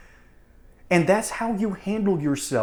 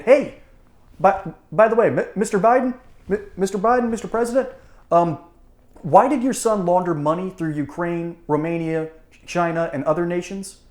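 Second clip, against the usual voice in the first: pushy reporter; 3 semitones higher, 4 dB louder than usual. Retold or imitated. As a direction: hammer him with reporter questions. Mock reporter questioning Biden.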